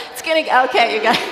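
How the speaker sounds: loud words broken by real laughter; laughing. Real, not acted. Preacher trying to steady the congregation, laughing loudly. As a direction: try to talk while cracking up. Breaking up laughing mid-sermon.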